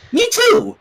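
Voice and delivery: very high bouncy chirp; squeaky. A narrator's reading. Pinkie agreeing to go, chirping high. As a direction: chirp it brightly.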